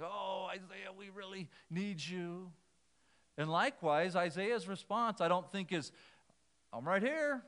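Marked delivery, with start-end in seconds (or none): 0.0-2.5 mock pleading. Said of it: "This isn't God pleading and saying". 6.7-7.4 high, eager call, hammed up; mock eager volunteer. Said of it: "I think Isaiah's response is timid and meek".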